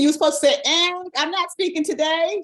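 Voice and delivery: high, loud, laughing mock scolding; playful teasing. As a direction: tease the whole group, grinning.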